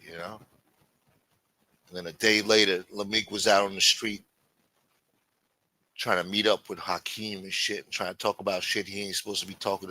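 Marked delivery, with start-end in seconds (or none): none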